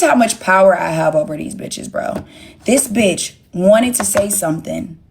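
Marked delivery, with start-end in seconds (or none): none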